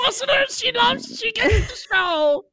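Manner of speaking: very high-pitched squealed delivery; excited squeal. Acted. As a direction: squeal it out to the listeners, drawing out the end. Host squeals praise to listeners.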